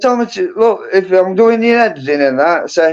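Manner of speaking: ordinary delivery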